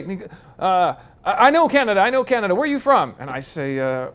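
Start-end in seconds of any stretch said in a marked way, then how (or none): 0.6-3.2 much higher, eager and chummy general voice; excited Texan general. Retold or imitated. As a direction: play the little Texan general, thrilled to meet them. Retold general from Texas talking.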